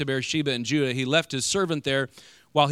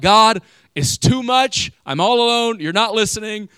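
desperate cry; 7 semitones higher, 8 dB louder than usual. Acted. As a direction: cry it out at the end of your rope. Preacher acts out desperate prayer.

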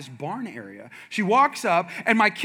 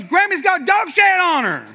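high and loud, louder than before; 11 semitones higher, 9 dB louder than usual. Retold or imitated. Son repeating it louder to dad, high and loud. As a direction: say it out loud, proudly. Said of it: "he said it louder".

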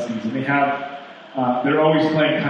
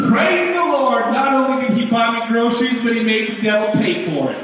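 grandma yells again; 8 semitones higher, 3 dB louder than usual. Retold or imitated. Grandma answering the neighbor with a triumphant high shout. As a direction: win the argument at full voice. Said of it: "yelled out again".

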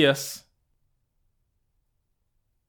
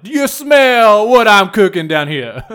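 slow, loud wrestler catchphrase voice, pitched up; The Rock impression; 5 semitones higher, 8 dB louder, much slower than usual. Joking about peeing in bushes, slow loud Rock catchphrase. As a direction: drawl it out like The Rock on the mic. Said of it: "pull the whole Dwayne "The Rock" Johnson".

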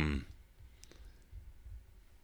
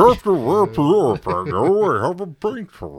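silly put-on voice; 9 semitones higher, 10 dB louder than usual. Silly voice joins the sign-off.

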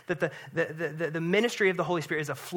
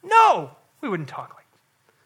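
firm rebuke; 4 semitones higher, 9 dB louder, slower than usual. Real, not acted. Pastor rejecting the timid tone.

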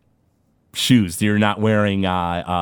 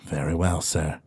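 a courteous butler speaking with measured calm; attendant NPC; 4 semitones lower than usual. Acted. Attendant calmly reassuring his confused master.

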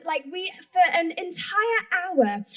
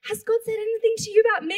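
high, quick excited asking; rushed, hopeful retold question; eager question, imitated; 6 semitones higher, faster than usual. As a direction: act out a friend bursting to know.

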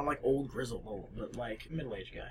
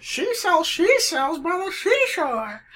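uppity New England voice; 11 semitones higher, slower than usual. Shown as a demonstration. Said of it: "I can do a really good uppity".

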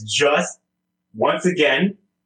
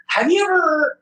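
high-pitched imitation with a stretched ending; Nick; 11 semitones higher than usual. Co-host imitating the guest's late-night message, high mimicking voice. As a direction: mimic a friend, pitched well up. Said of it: "gonna do it in the Nick voice".

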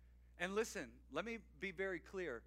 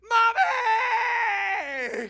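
put-on much higher, loud joyful child's cry; excited shout; 17 dB louder than usual. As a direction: yell it like a kid spotting mom. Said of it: "they go".

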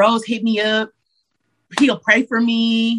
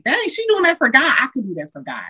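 put-on inspired little girl; 6 semitones higher, faster than usual. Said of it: "that little girl to be like".

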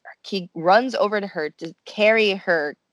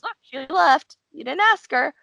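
teasing friend; 8 semitones higher than usual. Retold or imitated. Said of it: "And then he goes".

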